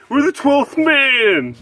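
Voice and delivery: high, slow mocking fan boast; hammed-up fan brag; mock Seahawks fan.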